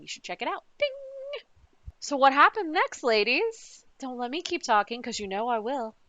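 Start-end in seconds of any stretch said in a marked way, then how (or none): none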